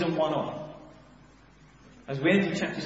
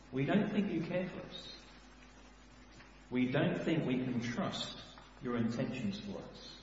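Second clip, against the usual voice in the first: quiet complaint; 4 semitones lower, 7 dB quieter than usual. Acted. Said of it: "That's what they're saying to God".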